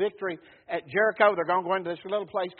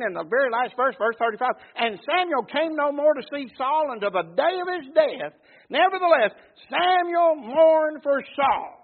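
loud, raised scripture reading; preacher ringing out the verse, higher and louder; forceful preaching; 5 semitones higher, 8 dB louder than usual. Acted. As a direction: proclaim the verse to the whole congregation.

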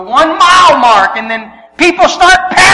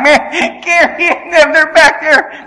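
louder, higher, laughing through the words; happy, gloating laugh; 5 semitones higher, 3 dB louder than usual. Retold or imitated. Runner gloating over friends behind him, happy laughing. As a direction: crow with laughter at those left behind. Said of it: "I was happy".

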